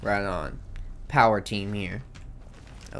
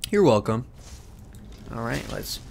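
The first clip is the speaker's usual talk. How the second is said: ordinary talk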